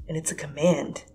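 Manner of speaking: plain reading voice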